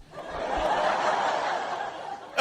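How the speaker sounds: soft airy villain laugh; breathy evil laugh. Acted. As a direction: snicker like a gloating villain. Gloating over the buyer.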